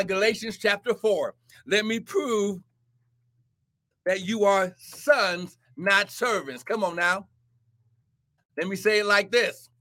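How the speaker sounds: usual speaking voice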